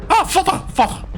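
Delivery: very high startled cry; startled son. Pretend. A son startled by danger cries high for father. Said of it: "Why am I talking like this?".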